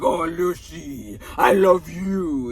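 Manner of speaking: put-on slow, swooning French-accented "Oh, Lucy"; fake French charm. Ricky's friend wooing Lucy, slow hammed-up French accent. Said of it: "turn on the French charm, turn on the accent".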